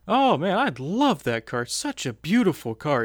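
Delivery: gushing imitation, high and loud; Simon Pagenaud impression. Driver admiring a fan's diecast car, gushing. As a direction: mimic an awestruck fan of the car, pitch swooping. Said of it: "I go up to him and he's like".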